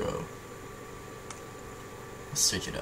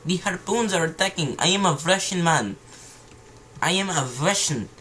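bad Russian accent. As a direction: exclaim as a hammy Russian man. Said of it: "how to do a Russian accent".

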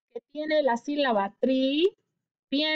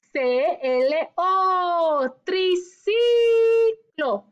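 "Triciclo" very slow, higher and louder; stretched-out word; 4 semitones higher, 4 dB louder, much slower than usual. Shown as a demonstration. Teacher stretching the whole word so kids count syllables. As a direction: sing out "Triciclo" long and bright for the class. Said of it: "Hay que estirar la palabra".

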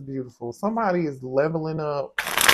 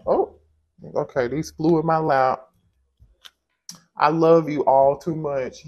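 ordinary talk

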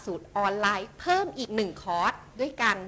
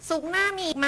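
much higher, eager mock asking; eager viewer question; 10 semitones higher, 4 dB louder than usual. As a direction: voice the viewers' keen question, pitched well up. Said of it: "ทุกท่านถามเข้ามามากเลยค่ะว่า". (ถามแทนผู้ชมอย่างตื่นเต้น เสียงสูงขึ้นมาก)

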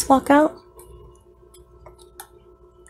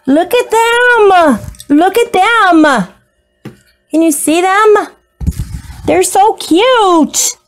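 higher, drawn-out gushing; slow, high doting coo over tiny pets; 6 semitones higher, slower than usual. Real, not acted. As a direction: stretch each word out in delight.

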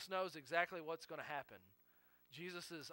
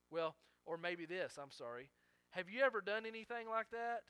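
ordinary talk

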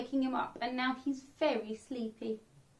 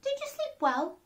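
much higher sweet question; cheery Missus Bear; 11 semitones higher than usual. Acted. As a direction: ask brightly, unaware. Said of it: "she said".

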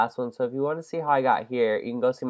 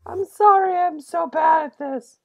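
whiny; 6 dB louder than usual. Real, not acted. Whining after failing.

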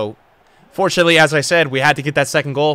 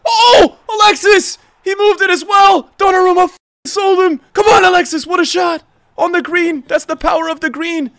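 much higher and louder, yelling; ecstatic goal shout; 12 semitones higher, 6 dB louder than usual. Real, not acted. Gamer screaming about Alexis's late goal, ecstatic shout.

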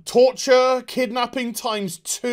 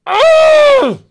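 very high, loud frustrated scream; furious scream; 13 dB louder than usual. Real, not acted. Vlogger screaming at the horribly written show, loud.

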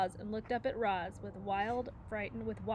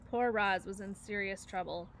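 ordinary delivery

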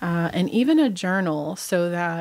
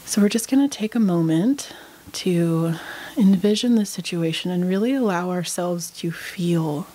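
unhurried meditation guide, long pauses between phrases; guided meditation. Real, not acted. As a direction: lead them calmly through breath and body, leaving space after each phrase.

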